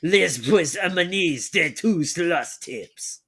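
slow, loud, hammed-up French reading; mock French accent. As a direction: read it like a French narrator. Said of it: "doing French accent".

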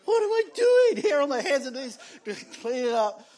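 mock-exasperated self-question. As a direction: laugh at your own absurd situation. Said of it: "I was kinda like".

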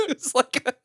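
usual speaking voice

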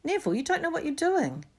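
high-pitched exasperated plea. A narrator's reading. Ron trying to reason with Neville, exasperated. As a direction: let exasperation push the pitch right up. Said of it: "said Ron in exasperation".

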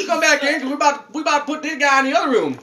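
put-on playful high-pitched Southern accent. Host calling the spirit back in, Southern accent. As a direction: put on a folksy Southern twang. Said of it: "I don't know why I got a southern accent".

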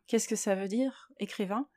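ordinary talk

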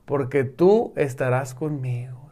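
a reverent hushed whisper of the psalm. Acted. Preacher to online brethren, quoting the psalm in a whisper. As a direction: breathe the promise out, barely voiced.

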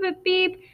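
high electronic beep imitation; beeping computer. A narrator's reading. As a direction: chirp it like a machine.

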